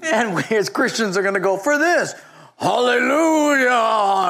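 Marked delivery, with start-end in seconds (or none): 1.6-4.3 higher, slow hammed-up hallelujah; mock churchy boast. Christians mimicked showing off.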